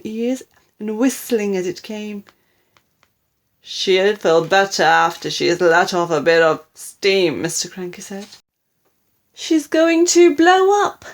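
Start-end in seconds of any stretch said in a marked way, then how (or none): 3.6-7.4 loud, confident reassurance; unconcerned husband. A narrator's reading. Husband dismissing grandma's steaming, loud unconcerned voice. 9.3-11.1 high, loud panicked wail; rising cry of alarm. A narrator's reading. Wife fearing grandma will explode, high panicked wail. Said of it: "Missus Cranky wailed".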